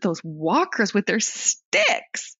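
slow, hammed-up American tourist; American accent. Shown as a demonstration. Host having another go at the tourists' line, exaggerated American accent. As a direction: draw it out in mock American. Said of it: "I can't do it".

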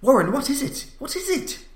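high, urgent question that starts loud; terrified. A narrator's reading. Narrator begging his friend for answers, terrified. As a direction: cry down the line in terror.

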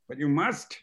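put-on laughing through a high German-accented plea; German secretary cracking up mid-line; German accent dissolving into laughter. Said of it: "She said".

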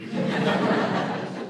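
a quiet airy chuckle; soft breathy laugh. Acted. Cub laughing quietly as he gives in. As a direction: laugh softly under the breath. Laugh inside cub's quote.

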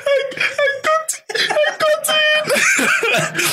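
very high, slow, sobbing laugh-shout; crying with joy. Real, not acted. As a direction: choke out the news through happy tears. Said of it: "I'm just crying".

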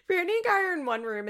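high, loud, tickled delivery; amused retake. Real, not acted. Podcaster restarting after cracking up at the room, amused. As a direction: say it high, just past a laugh.

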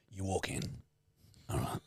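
normal talking voice

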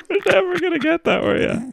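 high, laughing reveal. Real, not acted. Teasing her partner with the answer, laughing. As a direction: burst out laughing as you give it away.

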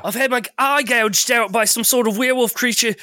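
put-on high, scared young man; frightened boy voice. Player trying to recall his character's voice, high and scared. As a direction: play the wounded frightened boy. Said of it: "can't even remember how to do the accent".

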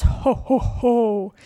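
lightly imitated Santa ho-ho; a demonstrated laugh from low down in the belly; mock Santa chuckle, lightly done; Santa's belly laugh. Shown as a demonstration. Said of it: "It's low down. It's deep".

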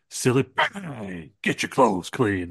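slow, drawn-out advert pitchman; audition slogan read. Acted. Wrestler auditioning for a cleaning advert, hammed-up slogan. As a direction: stretch the slogan out like a TV ad. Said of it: "you're gonna read for this".

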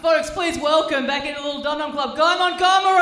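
building to a high, loud shout; announcer's introduction. Real, not acted. Host welcoming a guest back to the club, rising announcer shout. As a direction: hype the crowd, shouting the guest's name.

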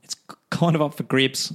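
put-on Kiwi accent on the whole quote; New Zealand accent. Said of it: "New Zealand accent".

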